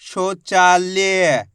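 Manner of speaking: "शौचालय" joined up, very slow; blended word, drawn out. Shown as a demonstration. Teacher joins the letters for learners, slow blended word. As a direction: stretch each vowel in "शौचालय". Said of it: "तो मिलके बन जाएगा".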